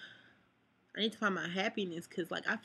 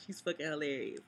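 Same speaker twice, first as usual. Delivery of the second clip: normal talking voice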